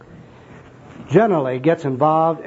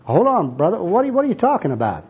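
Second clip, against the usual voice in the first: high, exasperated interruption; hammed-up bewildered objection; mock protest; 7 semitones higher than usual. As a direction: cut in, baffled and objecting. Bum interrupts the zealous evangelist.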